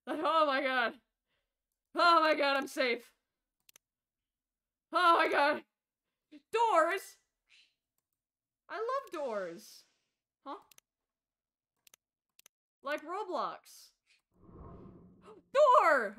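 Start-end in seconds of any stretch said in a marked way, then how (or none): 0.1-5.6 loud, breathless relief; relieved outburst. Real, not acted. Streamer safe after the jump scare, loud relieved cries. 15.5-16.2 loud sudden cry; startled shout. Real, not acted. Streamer jumping at the door, loud startled yell.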